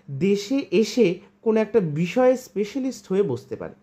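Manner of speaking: ordinary delivery, nothing marked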